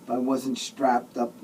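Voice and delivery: ordinary delivery, nothing marked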